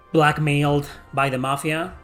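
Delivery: ordinary talk, nothing marked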